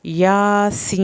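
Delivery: "یاسین" slowly with a sibilant s and long held i; whistling seen. Shown as a demonstration. Teacher showing the seen whistle, elongated "یاسین". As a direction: chant "یاسین" on a sustained tone. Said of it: "سین کی سیٹی کی آواز ضرور نکالنی ہے".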